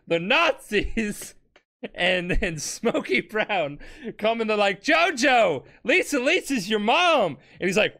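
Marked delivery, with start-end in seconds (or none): 4.8-7.4 mock announcing reveal. Nazis quoted revealing the mother.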